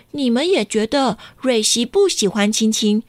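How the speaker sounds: ordinary delivery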